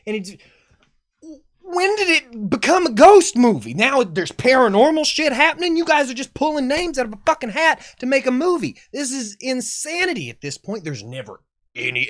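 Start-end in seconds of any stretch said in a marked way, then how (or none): 1.6-10.9 higher and louder, fed-up outburst; exasperated rant. Real, not acted. Reviewer fuming at the movie's writers, loud exasperated rant. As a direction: rage at the film's nonsense, full voice. Ranting about the movie's plot.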